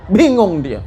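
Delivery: usual speaking voice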